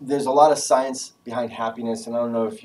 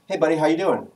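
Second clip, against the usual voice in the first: higher, lively phone hello; upbeat greeting; 5 semitones higher, 3 dB louder, faster than usual. Retold or imitated. Friend calling the lawyer buddy, upbeat greeting. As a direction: greet a buddy with some bounce. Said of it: "when you talk to him".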